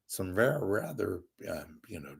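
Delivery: ordinary talk, nothing marked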